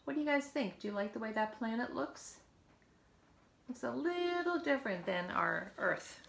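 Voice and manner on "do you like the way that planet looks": ordinary delivery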